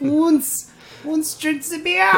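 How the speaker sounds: high, slow mock German voice; fake German accent. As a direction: ham up a fake German drinker. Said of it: "all my German impressions are fake German".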